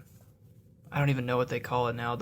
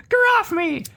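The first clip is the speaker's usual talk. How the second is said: high, loud squeaky gnome squeal; 6 dB louder than usual. Acted. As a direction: squeal like a grabbed gnome in protest. Reading out a gnome's protest.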